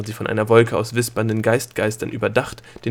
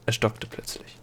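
ordinary delivery, nothing marked

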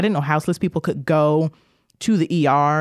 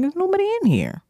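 higher, sly mock voice; mock sly man; 13 semitones higher, faster than usual. Imagining the man alone in his room, higher mock voice. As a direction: play him as a pleased schemer.

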